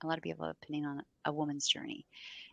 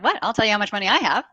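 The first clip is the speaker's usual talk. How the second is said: higher, much louder, fast; aunt reacting to her shy niece; incredulous outburst; 5 semitones higher, 14 dB louder, faster than usual. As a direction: blurt it out in disbelief. Aunt's incredulous reply.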